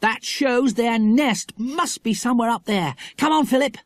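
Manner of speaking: put-on high, eager young adventurer; thrilled child, pitched well up; excited Jack. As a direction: urge a friend on excitedly. Story dialogue as Jack.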